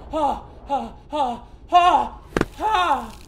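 exaggerated exertion breaths; effortful panting "ha". Real, not acted. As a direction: huff out each "ha" with effort.

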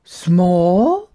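dawning delight with a rising question; amazed little yak. Acted. Little yak realising smallness is useful, amazed. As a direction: build from wonder to excited realisation. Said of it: "Gertie gasped".

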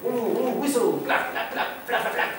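onomatopoeia performance. Shown as a demonstration. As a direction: fire off nonsense noises in rapid percussive volleys. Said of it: "ça donne ça".

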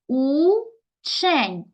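"учень" as a long у, pause, чень; split into syllables. Shown as a demonstration. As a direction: draw out and separate both syllables of "учень". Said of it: "Поділи на склади".

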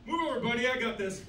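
ordinary talk, nothing marked

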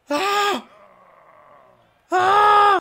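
high piercing yell of frustration; sharp panicked scream; stressed scream. Pretend. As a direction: let out a frantic shriek.

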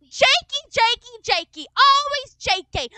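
mock the name with disgust; scornful mother. Mother mocking the boy's name.

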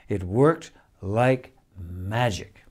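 ordinary delivery

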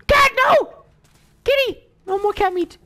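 very high gasp and wail over the cat; horrified cry. Real, not acted. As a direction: cry out as if the cat just died. Horror at cat being killed.

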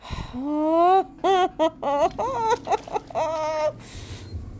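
long, high quavering wail; hysterical sobbing laugh. Real, not acted. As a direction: break into hysterics, half laughing, half crying. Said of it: "okay, hysteric's over".

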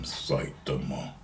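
low, slow menacing villain growl; anime villain voice. Shown as a demonstration. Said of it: "that's how they would say his name".

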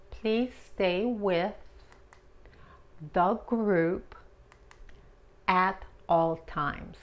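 slow careful "Please stay with the group"; teacher reading English slowly; slow model phrase. Shown as a demonstration. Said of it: "model phrase".

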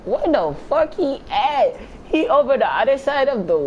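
high-pitched, exasperated disbelief; baffled outburst. Real, not acted. Gamer hunting a hidden rival, baffled outburst. Can't find the player he's hunting.

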